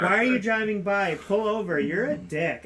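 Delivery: put-on high, whiny childlike drunk; drunk ex-wife. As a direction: imitate a drunk tantrum, dragging out the demands. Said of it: "this is how she talks".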